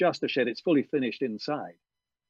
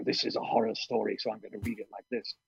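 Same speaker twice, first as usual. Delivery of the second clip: hushed, hurried horror-story voice; spooky horror narrator; 3 semitones lower, 6 dB quieter than usual. Shown as a demonstration. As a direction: put on a creepy narrator voice for a scary tale. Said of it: "to put on a voice as the narrator".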